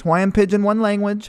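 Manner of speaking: Hawaiian Pidgin accent. Shown as a demonstration. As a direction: show off the Hawaiian Pidgin lilt playfully. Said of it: "How's that?".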